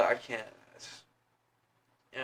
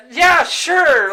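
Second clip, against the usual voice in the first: high, loud mock shout; exasperated reply; 14 semitones higher, 13 dB louder than usual. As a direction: shout it as forced agreement. Acting reply about gift card.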